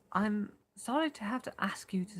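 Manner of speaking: whispered British accent attempt. Shown as a demonstration. Narrator testing a British voice for Warren, whispered British. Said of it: "that's British".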